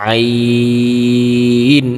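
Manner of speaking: আইন drawn out slowly, pressed from the throat; throat-pressed ain. Shown as a demonstration. Teacher showing students how to press আইন from the throat. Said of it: "গলা একটু pressure দিয়ে পড়তে হবে".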